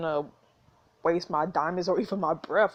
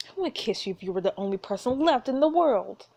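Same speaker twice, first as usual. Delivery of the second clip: ordinary talk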